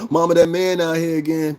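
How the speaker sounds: put-on child voice. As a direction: kid calling out to mama. Child calling mama again.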